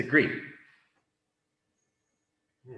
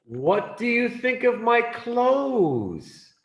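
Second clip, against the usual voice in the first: much higher, slow acted question; high-pitched question; 11 semitones higher, 3 dB louder than usual. Character asks friend about his clothes, high-pitched. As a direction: ask in a high, drawn-out voice. Asked again in second clothes scene.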